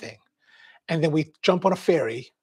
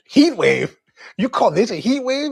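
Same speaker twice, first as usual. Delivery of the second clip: louder, higher mock disbelief; incredulous; 8 semitones higher, 7 dB louder than usual. East Coaster scoffing at San Francisco locals, loud disbelief. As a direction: scoff at the idea of heat wave. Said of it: "I was like".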